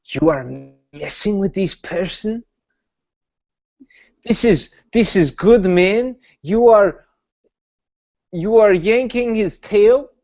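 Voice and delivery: put-on high incredulous accented scolding; in-character scolding.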